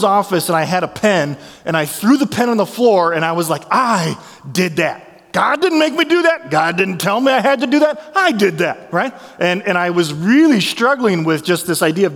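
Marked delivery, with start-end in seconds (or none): 3.7-8.9 high indignant I did that; mock defiant outburst. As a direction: protest it to the dean, stung. Retelling throwing pen in anger.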